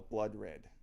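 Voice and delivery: ordinary talk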